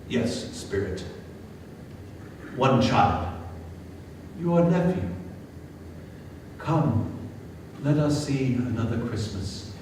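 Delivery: put-on an old man quietly grieving his sister; hushed, sorrowful Scrooge. A narrator's reading. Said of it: "old Scrooge answering spirit".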